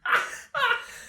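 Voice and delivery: high-pitched pretend sobs; mock sobbing. Game master plays the crying cleric, mock sobs. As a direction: burst into hammed-up crying. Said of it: "While still crying".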